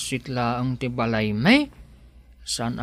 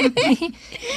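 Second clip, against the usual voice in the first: high giggle. Acted. Giggle after invitation.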